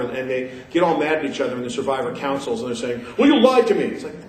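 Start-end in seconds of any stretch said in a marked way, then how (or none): 3.2-3.8 a hammed-up, higher, louder "you lied to me"; mock whiny accusation. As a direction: whine it like an offended contestant. Mocking angry Survivor contestant.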